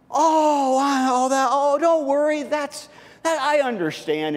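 high, fumbling reassurance; flustered politeness. Acted. You reassuring the apologetic neighbor, high and flustered. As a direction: stumble over being gracious.

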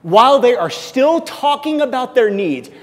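loud, proclaiming Bible reading; scripture reading. Acted. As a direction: declare the verses to the room. Reads Isaiah verse to congregation.

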